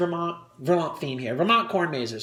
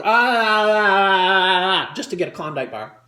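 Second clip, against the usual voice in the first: loud, long open-mouthed "ah"; sustained wordless tongue-out noise; 3 semitones lower, 3 dB louder than usual. Acted. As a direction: drag out an ah with the tongue hanging out. Mimes licking the floor with tongue.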